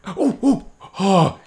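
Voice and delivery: thrilled shrieks of disbelief; shrieking jackpot winner. Real, not acted. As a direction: squeal like you just won the jackpot. Finally got the item.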